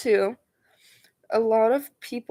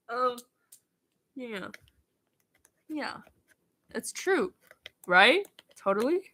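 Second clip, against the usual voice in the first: ordinary talk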